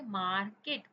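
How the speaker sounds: Market split into two beats, मार and केट; syllable by syllable. Shown as a demonstration. Teacher showing children how to count syllables, syllable by syllable. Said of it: "कितने syllabus है यहाँ पर".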